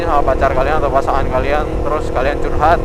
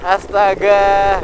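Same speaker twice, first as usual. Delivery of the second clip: exasperated groan; 6 semitones higher, 4 dB louder, much slower than usual. Real, not acted. Drenched by the downpour.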